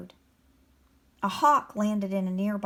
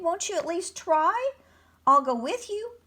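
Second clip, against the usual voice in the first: higher, rising, coaxing plea; pleading; 8 semitones higher than usual. A narrator's reading. Mole offers the dragon company, pleading. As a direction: urge them gently to try. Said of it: "the mole pleaded".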